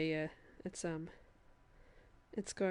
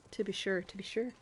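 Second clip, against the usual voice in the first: stock Irish catchphrase in a mock brogue; put-on Irish lilt. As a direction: play up the cliché Irish accent. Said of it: "stereotypical Irish phrases".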